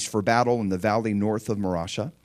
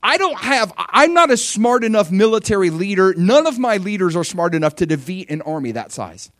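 higher, louder desperate plea to God; Asa's desperate prayer; 5 semitones higher, 5 dB louder than usual. Retold or imitated. King Asa pleading with God before an impossible battle, higher and louder. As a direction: cry out to God with nothing left. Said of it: "he says".

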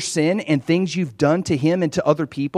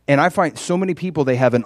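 ordinary delivery

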